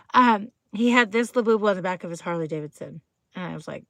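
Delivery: normal talking voice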